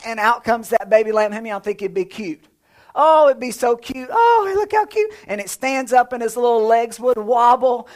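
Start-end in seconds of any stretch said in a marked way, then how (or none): 2.9-5.1 a higher, gushing coo. Acted. Preacher coos over the newborn lamb, higher and gushing. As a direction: gush over the cute lamb.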